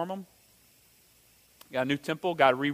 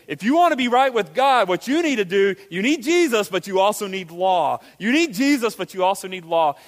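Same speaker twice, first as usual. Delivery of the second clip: hammed-up false teacher, higher and louder; mock false teacher; 3 semitones higher, 4 dB louder than usual. Pastor voicing a false teacher to the church, mock insistence. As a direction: lay out the false teacher's demand with mock urgency.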